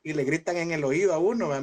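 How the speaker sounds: ordinary delivery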